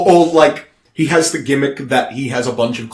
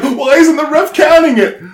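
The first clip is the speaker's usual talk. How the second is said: high, loud indignant question; exasperated wrestler; 12 semitones higher, 8 dB louder than usual. Acted. Competitor pins clone but gets no count, exasperated. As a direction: protest loudly in disbelief.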